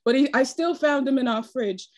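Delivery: podcast host in a lightly put-on British accent; hosting in a playful mock British accent. Host welcomes the guest in a mock British accent. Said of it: "why I have this accent".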